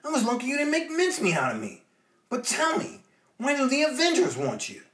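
put-on eager young hero asking; Spider-Man voice. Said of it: "Spidey replies".

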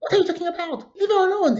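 high mock yell defending her; imitating a young guy shouting at the lady; retold yelling. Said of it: "started yelling after her".

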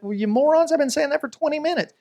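high-pitched, exasperated complaint; mock exasperation. Overlooked speaker answering the crowd who ignored them, mock exasperation. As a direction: protest like the overlooked one. Acts out being denied credit.

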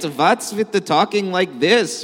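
put-on lilting comic Yiddish accent. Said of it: "it sounds a little Yiddish".

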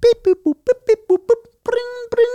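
high beep-boop dialing sounds; phone dialing noises. Acted. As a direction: make the phone beep and ring.

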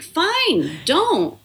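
high, clipped-off surrender; exasperated giving up. Retold or imitated. Babysitter giving up on the kids, high exasperated. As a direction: throw up your hands, high. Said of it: "And I was just like".

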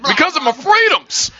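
high mocking whine of a freedom guy. Mocking the commenters, a whiny freedom guy. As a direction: lampoon the angry commenter.

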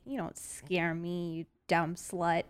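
slow, quiet, low defiant taunt; low menacing taunt. Pretend. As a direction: stare it down and sneer it softly. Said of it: "I can look it in the eyes and I can say".

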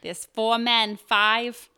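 loud Boston attempt slipping into Jersey; attempted Boston accent. Shown as a demonstration. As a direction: try a Boston accent that lands nearer New Jersey. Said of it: "That's more Jersey".

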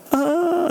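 a high, delighted parent's "ah" over a newborn; delighted parent sigh. Retold or imitated. Parent gazing at newborn, high delighted sigh. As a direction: coo at the baby with pure delight.

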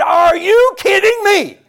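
loud, high, slow shouted rebuke; God's rebuke. Retold or imitated. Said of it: "He said".

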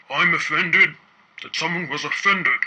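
a hint of gravelly pirate; lightly voiced gravelly pirate. A narrator's reading. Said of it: "in his gravelly pirate voice".

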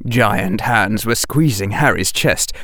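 ordinary delivery

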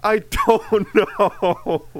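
laughing through the words; slow words broken by laughter. Real, not acted. Laughing at the joke.

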